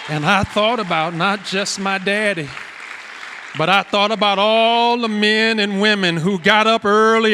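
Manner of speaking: loud, high-pitched sermon climax; preaching at full force. Real, not acted. Preacher turns from his daddy to all parents, full force. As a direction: lift it to the whole congregation, loud and sustained.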